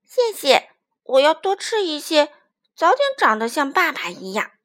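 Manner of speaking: put-on high, loud little-child voice; childlike, full of admiration; eager baby dinosaur. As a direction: chirp it like a keen youngster.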